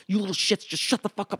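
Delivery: high, strained mock scolding; imitated angry scolding. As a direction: scold like a fed-up stepdad. Stepdad scolding laughing teens at dinner.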